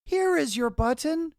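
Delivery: Frog's high, excited call of discovery; cried out. A narrator's reading. Frog calls to Toad, eagerly and high. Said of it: "cried Frog".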